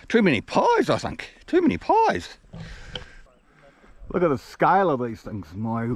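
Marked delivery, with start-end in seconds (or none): 0.1-0.9 higher and louder quip; jokey quip. Acted. Joking about being out of breath. 1.5-2.4 much higher squeaky repeat of "pies"; joke repeated much higher. Acted.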